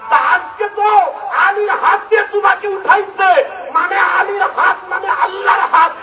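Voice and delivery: high shouted sermon chant, building; fiery preaching. Acted. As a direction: shout the revelation to the crowd.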